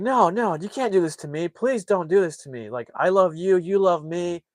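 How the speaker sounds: high-pitched, desperate begging, acted out; imitated whiny plea; mock pleading. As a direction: beg a fiancée not to leave, hammed up. Retold pleading with fiancée on phone.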